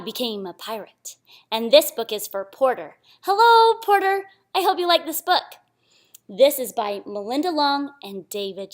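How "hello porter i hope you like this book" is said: bright greeting. Real, not acted. Greeting the dedicatee.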